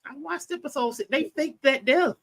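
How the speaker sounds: small high-pitched voice, insisting. Real, not acted. Soft high comeback in plot argument.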